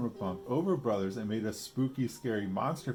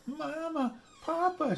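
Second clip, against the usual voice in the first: high frightened calling out; scared cry for help; 3 dB louder, slower than usual. A narrator's reading. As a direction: cry out for her parents in fright. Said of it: "she cried".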